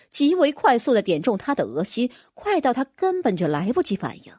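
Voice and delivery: plain reading voice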